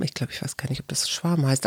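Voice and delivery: softly whispered, unsure aside. Real, not acted. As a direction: drop to a whisper for the doubt.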